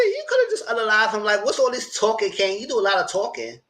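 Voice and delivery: high-pitched, mock exasperation; exasperated scolding. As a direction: needle him for stalling. Scolding the character for talking.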